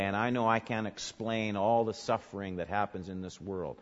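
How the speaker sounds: usual speaking voice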